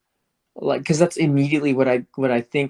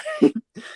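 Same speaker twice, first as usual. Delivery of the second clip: high laugh. Real, not acted. Laughing after redo.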